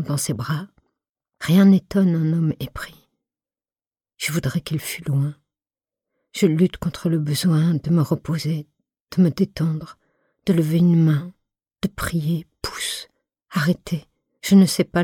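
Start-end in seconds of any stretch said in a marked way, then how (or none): none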